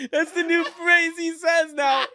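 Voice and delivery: high, loud, slow words through laughter; helpless laughter. Real, not acted. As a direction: squeal it out while cracking up. Cracking up while telling the story.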